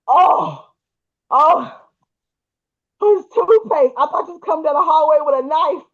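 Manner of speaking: loud, high-pitched startled outburst; startled relief. Retold or imitated. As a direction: imitate him crying out in alarmed relief. Retold husband's startled relief at the toothpaste.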